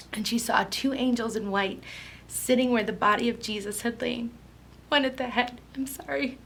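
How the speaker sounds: fighting back tears while reading on; near tears. Real, not acted. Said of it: "I'm gonna cry right now".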